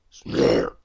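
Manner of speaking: very low, rough snort; bull bellowing snort. Acted. Bull in the tangled heap, bellowing snort. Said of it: "bellowed the bull".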